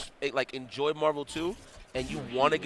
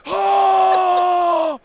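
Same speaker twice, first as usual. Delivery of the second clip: very high, loud horrified yell; disgusted shout; 13 dB louder than usual. Retold or imitated. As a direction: scream it as if someone is throwing up beside you. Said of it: "And Matrix is like".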